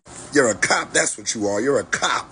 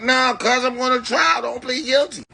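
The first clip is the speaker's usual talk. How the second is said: higher, pleading voice as himself on trial; urging the codefendant; 10 semitones higher than usual. Retold or imitated. The rapper urging his codefendant in court, a high pleading tone. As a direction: beg your codefendant not to cop out. Acting out the co-defendant's trial talk.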